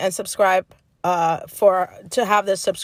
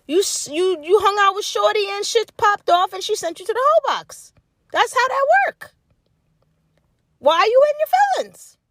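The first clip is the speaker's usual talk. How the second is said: rising disbelief at the dude's sulking; why are you even upset, raised high; exasperated scolding; 10 semitones higher than usual. Real, not acted. Scolding the dude, high and loud.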